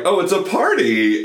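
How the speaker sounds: put-on high, bright-eyed sweet girl; lightly voiced naive girl at a party. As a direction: gush it like a delighted innocent. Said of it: "she's like".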